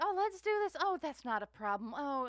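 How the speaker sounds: high, loud, eager yes-man voice; breezy yes-man. Retold or imitated. Mimicking eager management saying yes.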